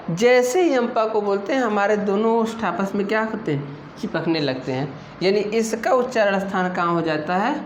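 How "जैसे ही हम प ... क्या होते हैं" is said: ordinary talk, nothing marked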